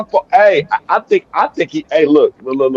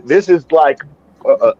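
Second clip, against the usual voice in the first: ordinary talk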